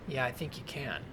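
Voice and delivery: low, quiet, suspicious man; lightly voiced guarded loner; wary stranger.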